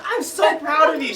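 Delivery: high, loud gushing teacher voice, near tears; overjoyed teacher. Retold or imitated. Teacher cheering his win, high and gushing. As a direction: gush like a thrilled teacher. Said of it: "She goes".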